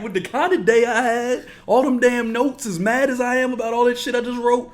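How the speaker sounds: mock furious rant. Threatening an old neighbor, loud high furious rant.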